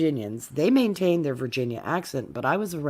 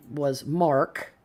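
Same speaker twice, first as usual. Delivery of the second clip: ordinary delivery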